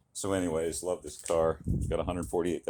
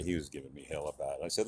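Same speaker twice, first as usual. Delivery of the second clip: normal talking voice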